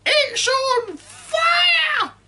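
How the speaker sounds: put-on high, loud screeching old woman; cranky grandma yelling from the roof; shouting grandma. A narrator's reading. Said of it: "Grandma yelled".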